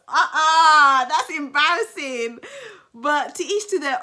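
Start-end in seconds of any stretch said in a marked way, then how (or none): 0.1-2.4 loud, high, half-laughing protest; big loud refusal, pitched up; appalled. Real, not acted. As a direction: cry out in amused horror. Reacting to imagined wife's put-down.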